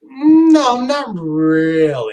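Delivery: high, slow, stretched-out denial; drawn-out no. Real, not acted. Doubting a co-host's comparison, long drawn-out answer. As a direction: start high and stretch the words right out.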